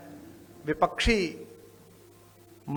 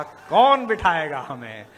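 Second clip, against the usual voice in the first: slow, defiant retort, a touch louder; lion roused; 4 dB louder, slower than usual. Retold or imitated. Defence minister defying a heckler in parliament, slow and defiant. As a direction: let the lion in him answer back, unhurried. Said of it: "Singh जागा".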